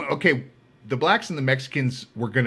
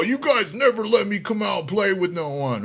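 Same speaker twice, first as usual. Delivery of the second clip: whiny inmate; 7 semitones higher than usual. Retold or imitated. Inmate whining to guards.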